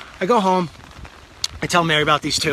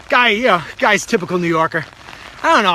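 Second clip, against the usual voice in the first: usual speaking voice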